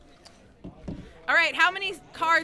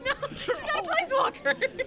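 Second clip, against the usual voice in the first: high laughing correction; 13 semitones higher than usual. Real, not acted. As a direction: laugh through the no. Host laughing at wrong answer.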